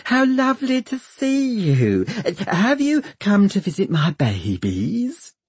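very high, gushing doctor; high-pitched fawning welcome; simpering. A narrator's reading. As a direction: coo it sweetly in a high simper. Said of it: "she simpers".

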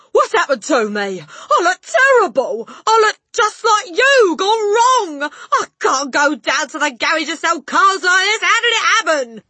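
loud, high-pitched wailing; horrified yell. A narrator's reading. Father staring into mirror, panicked yell. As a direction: wail in panic at full voice. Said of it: "yelled the father".

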